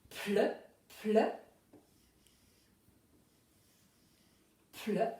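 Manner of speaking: "ple" as a lone blend with a short neutral vowel; sounded-out blend. Shown as a demonstration. Teacher breaks down plou for pupils, sounding out the blend. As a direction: repeat "ple" separately, pausing after each. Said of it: "on a besoin du".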